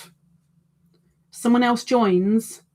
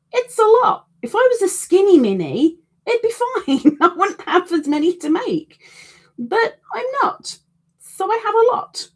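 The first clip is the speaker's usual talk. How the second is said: playful high-pitched moan about the work; high silly voice; 13 semitones higher than usual. Acted. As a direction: squeak it out as a self-mocking joke. Joking about her size.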